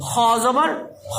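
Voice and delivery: "খ" with a heavy rounded vowel; thick Kha. Shown as a demonstration. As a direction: spell it, then a full drawn-out "খ". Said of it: "মোটা করতে হবে sound".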